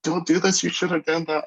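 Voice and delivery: high-pitched mock coach's yell; imitated sideline shout. Coach calling to competitor from the sideline, mock shouting. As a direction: call it out urgently, imitating a coach. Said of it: "shouting from the sideline".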